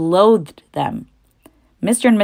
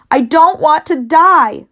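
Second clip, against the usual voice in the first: high, loud, slow scream; 11 semitones higher, 7 dB louder, slower than usual. Acted. Wilbur screams, throwing himself down, high and slow. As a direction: throw it at the ground in despair. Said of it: "screamed Wilbur".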